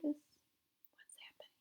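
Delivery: slow whispered question; whispering. Real, not acted. Teacher aside to child listeners.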